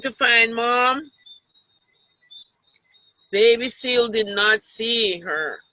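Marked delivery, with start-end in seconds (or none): none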